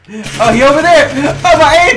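loud, high, rising yell; startled alarm. Real, not acted. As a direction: yelp as you spot the enemy. Spots opponent during game.

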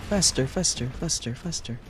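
being chased. Real, not acted. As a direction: urge her on, then trail off. Urging her character to flee.

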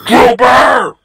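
loud shouted name; angry shout. Acted. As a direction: bellow the name at the culprit. Angry call at Trooper.

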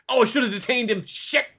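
high, loud frustrated outburst; mad at oneself. Real, not acted. Inspector regrets letting a traveller go, frustrated outburst. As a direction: kick yourself out loud. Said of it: "Now I'm mad for not detaining him".

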